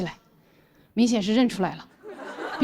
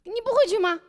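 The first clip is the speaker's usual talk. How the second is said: much higher, timid foreigner whining; Thomas pleading; 10 semitones higher than usual. Retold or imitated. Thomas begging his friend to go back, high and timid. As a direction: whine like the helpless friend who can't argue in Chinese. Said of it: "托马斯：".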